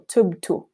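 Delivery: "tubtu" with the last dhamma close to u; final dhamma. Shown as a demonstration. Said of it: "hear how that dhamma sounds".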